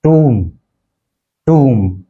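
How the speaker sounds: टूम as TOOM with silent B; टूम rhyming with "room"; correct pronunciation. Shown as a demonstration. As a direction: say टूम with a long "oo". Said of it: "actual इसका pronunciation है".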